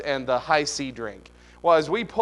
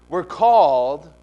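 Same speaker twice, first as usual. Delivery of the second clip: emphatic preaching; 9 dB louder, slower than usual. Real, not acted.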